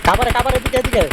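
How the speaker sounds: high urgent call to teammates. Real, not acted. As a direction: shout them over here. Calling teammates over mid-fight.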